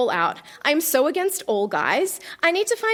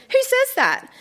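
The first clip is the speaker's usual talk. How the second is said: indignant; 11 semitones higher than usual. Acted. Diary outrage read aloud.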